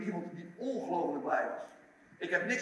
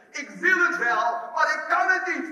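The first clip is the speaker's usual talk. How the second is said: loud, high, emphatic confession; frustrated admission; 9 semitones higher, 10 dB louder than usual. Retold or imitated. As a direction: cry out in acted helplessness. Voices Peter's despairing conclusion. (luid, gefrustreerd uitroepend)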